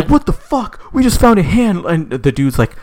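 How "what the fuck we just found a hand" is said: high-pitched acted panic; freaking out. Girl and guy find a severed hand in a shoebox, freaking out. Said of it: "they're freaking out".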